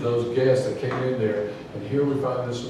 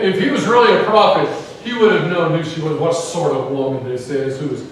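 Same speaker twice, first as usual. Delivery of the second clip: disgusted Pharisee; 4 semitones higher, 7 dB louder than usual. Retold or imitated. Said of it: "You see the disgust?".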